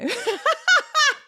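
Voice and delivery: a high, loud burst of laughter; high giggling burst at the gloomy poem. Real, not acted. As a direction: crack up out loud.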